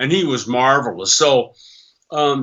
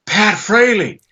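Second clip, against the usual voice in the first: excited fan; 6 semitones higher than usual. Retold or imitated. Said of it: "And he goes".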